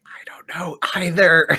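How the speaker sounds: high whisper, clueless; hushed little confession; whispered aside. Acted. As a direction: whisper back to a friend in secret. Subtle aside answering Grayson's whisper.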